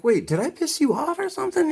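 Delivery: mock-bewildered high-pitched asking; retold baffled question. Customer asking the shop owner what went wrong, incredulous.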